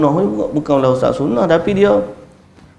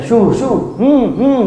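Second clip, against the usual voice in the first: high "syu, syu" and grunted "hmm, hmm"; cattle-herding calls; 6 semitones higher, much slower than usual. Acted. Preacher acting out driving a cow to the right, herding calls. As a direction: shoo a cow along in a raised voice.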